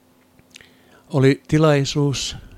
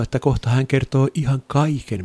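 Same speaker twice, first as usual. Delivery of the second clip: ordinary delivery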